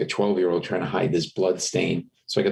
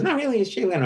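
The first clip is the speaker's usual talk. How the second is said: insistent impression, pitched way up; lousy celebrity impression, much higher; Jay Leno; much faster than usual. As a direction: imitate a high-voiced talk-show host. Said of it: "you do a lousy Jay Leno".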